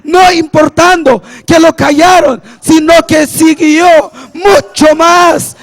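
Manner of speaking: fervent pulpit proclamation; rousing preacher cadence; full-force preaching. Real, not acted. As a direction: build to praise with emphatic, punched phrases.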